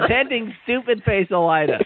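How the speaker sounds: Attending Stupid Face Elida read with a high teasing lift; mock chapter title. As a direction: read the silly title deadpan as if real. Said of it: "It says".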